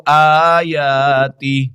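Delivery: slow, drawn-out melodic tilawat; Quran recitation. Acted. Recites the verse. (ترتیل کے ساتھ تلاوت)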